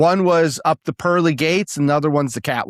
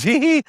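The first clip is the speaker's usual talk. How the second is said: high mocking hee-hee giggle; high mock giggle of a crazy guide; imitated giggle; 12 semitones higher, 3 dB louder than usual. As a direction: mimic the guide's giddy little laugh. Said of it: "he laughed at me".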